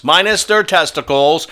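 usual speaking voice

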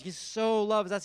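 normal talking voice

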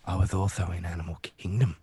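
low, hushed Australian character voice; Ben Mendelsohn. Acted. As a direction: play a soft, low-voiced Aussie actor. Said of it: "does a Ben Mendelsohn impression".

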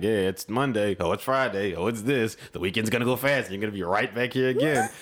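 low, weary recital of stock phrases; mock rote small talk. Worker mimicking the same daily conversations, droning and low. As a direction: reel off the stock lines, pitched low.

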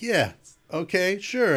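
high, drawn-out doubtful yeah okay sure; skeptical. Retold or imitated. Performer recalls brushing off a promised play, sarcastically.

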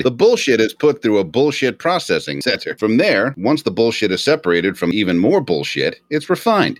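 ordinary delivery

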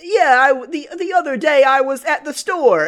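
high-pitched mock of a failed Italian accent; bad Italian accent. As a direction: imitate someone fumbling an Italian accent. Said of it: "But instead he sounds like".

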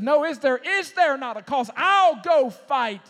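David stepping up, loud and sure; bold defiant cry. Acted. Preacher voicing David, a loud defiant vow to fight.

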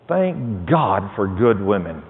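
usual speaking voice

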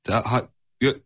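breaking up, stuttering syllables; words cut off by bad signal; bad connection. Real, not acted. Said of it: "Ver, ver, çekmiyor".

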